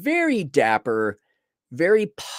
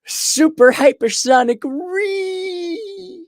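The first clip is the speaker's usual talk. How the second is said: mock hype; 4 dB louder, much slower than usual. Mock sports hype, cracking voice.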